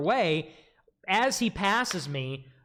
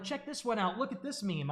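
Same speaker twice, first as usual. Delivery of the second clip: much softer, higher mock nerd voice; lightly voiced eager meme guy, softer; nerdy meme guy; 5 semitones higher, 8 dB quieter than usual. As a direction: imitate the guy eagerly showing memes, softly. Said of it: "He's, like, next to me all night".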